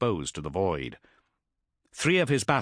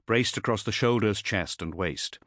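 usual speaking voice

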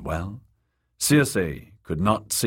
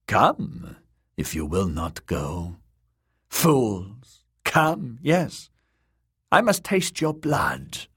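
put-on creepy old witch; 3 semitones higher, slower than usual. Witch lures the girls closer.

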